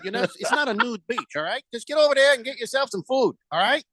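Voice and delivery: badly faked island welcome in a Caribbean accent; mock Caribbean accent. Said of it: "none of them sound like that".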